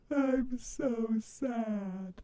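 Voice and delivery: slow, drawn-out falsetto whimper; a camp mock wail; mock sad. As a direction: fake sadness, very slow and soft. Mock flip to sadness.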